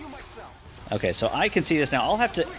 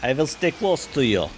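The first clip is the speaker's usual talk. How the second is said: lightly put-on Russian accent; a hint of a Russian accent; 3 semitones higher than usual. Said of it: "My Russian accent is better than yours".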